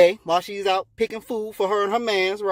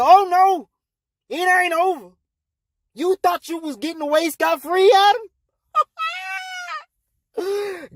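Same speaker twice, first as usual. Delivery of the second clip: high, raised-voice scolding; indignant; 10 semitones higher than usual. Acted. God turns to punish Adam, indignant. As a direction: pounce on someone who thought he escaped.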